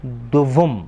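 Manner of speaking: दुवुम with rounded u sounds, du-vum; teacher modelling दुवुम; correct Persian duvum. Shown as a demonstration. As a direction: say दुवुम slowly and clearly. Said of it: "जो सही तलफ़ुज़ है वो है".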